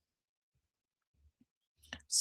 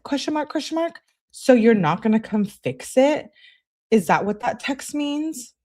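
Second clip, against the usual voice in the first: higher-pitched, disbelieving read of an angry text; incredulous questioning; 7 semitones higher than usual. Acted. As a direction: act out a texter who can't believe it. Acted texted quarrel, disbelieving reply.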